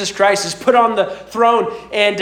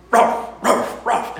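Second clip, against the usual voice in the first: dog bark imitation; 2 semitones higher, slower than usual. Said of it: "you just hear through the monitor".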